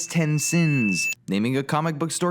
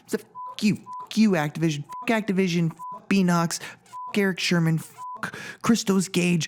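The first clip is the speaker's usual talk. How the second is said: angry rant; 3 semitones higher than usual. Acted. Fed-up rant at game makers.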